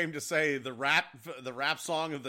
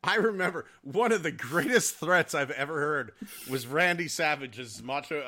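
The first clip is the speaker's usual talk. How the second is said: ordinary talk, nothing marked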